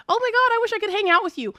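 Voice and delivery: high, rushed fan gush. Retold or imitated. Fans wishing to hang out with the streamer, imitated gushing. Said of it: "people are like".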